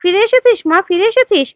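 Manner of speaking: mother greeting her returned daughter, overjoyed. A narrator's reading. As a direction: cry out in relief that she's back. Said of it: "অপুর মা খুশি হয়ে যায়".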